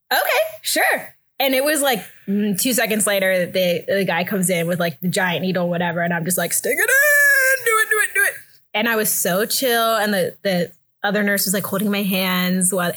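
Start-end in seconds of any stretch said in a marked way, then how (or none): 0.1-1.1 startled agreement. Retold or imitated. Staff's startled reply, acted. 6.5-8.3 hammed-up panicked begging; frantic demand. Acted frantic demand for the needle.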